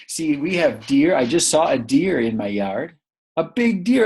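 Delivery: usual speaking voice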